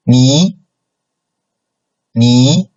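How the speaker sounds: NI sliding upward; second tone. Shown as a demonstration. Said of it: "second tone".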